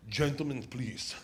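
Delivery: low, composed German woman; cool, low-voiced authority; stern German woman. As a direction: call for order, low and controlled. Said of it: "German woman, six feet tall".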